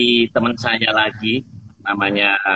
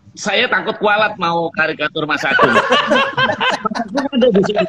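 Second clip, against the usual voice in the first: laughing; 10 semitones higher than usual. Real, not acted. Laughing while talking.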